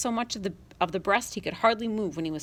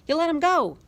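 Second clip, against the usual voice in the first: angry yell; 9 semitones higher, 5 dB louder than usual. Acted. Boy yelling at sister.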